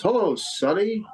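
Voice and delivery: put-on high, slow, cheery old-man hello; grandpa's greeting. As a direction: stretch out a sweet hello the way grandpa would. Grandfather greets him in vision.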